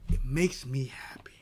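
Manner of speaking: hushed, voiceless gloating confession; sinister whisper. Retold or imitated. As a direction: whisper it like a spiteful secret. Voicing a spiteful woman's thoughts.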